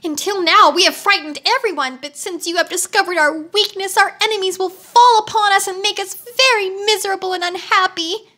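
high, mildly tearful wail; a touch of sobbing, voice high; sobbing wheeler. A narrator's reading. Ruined wheeler laments to Tick-Tock, mild high sob. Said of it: "he sobbed".

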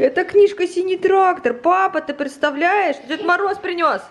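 high, delighted sing-song; excited toddler talk. Acted. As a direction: share the wonder with a small child. Shows gift to toddler.

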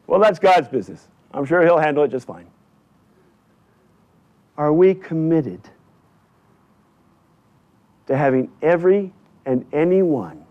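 ordinary delivery

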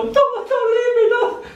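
high, fast pleading cry; desperate plea. Acted. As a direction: beg her not to leave, voice jumping up. Pleading with departing Death.

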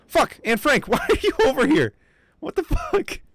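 high, loud startled yell with a laugh; shocked shriek. Real, not acted. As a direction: shriek in disbelief at the game. Game character wanders off.

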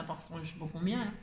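ordinary delivery

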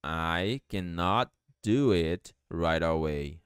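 slow, word-by-word teaching delivery; low, slow language-teacher demonstration; slow model sentence. Shown as a demonstration. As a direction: spell out each word slowly for learners. Teacher models sentence slowly, then faster.

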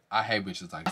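low, quiet grumble; muttered aside. Real, not acted. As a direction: mutter it to yourself, fed up. Repeats again quietly.

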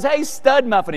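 high-pitched imitation of wife calling; mock calling out. Comedian retelling his wife's call, high mock yell. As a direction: holler it playfully in a high voice. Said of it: "she yells out".

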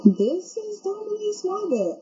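ordinary delivery

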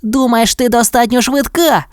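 put-on high-pitched childlike challenge; challenging question. A narrator's reading. Puppy brother doubting his sister, high challenging voice.

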